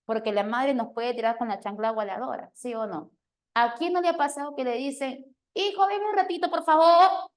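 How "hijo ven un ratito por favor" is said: high, louder mother's call; mother summoning her son, pitched high and loud; annoyed mother calling. Retold or imitated. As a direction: call the kid with a warning edge.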